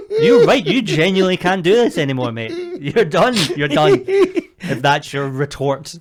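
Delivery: loud, high, laughing self-ridicule; mock despair. Comic scolding own feeble banana retort, mock despairing. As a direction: berate yourself at full volume, half laughing.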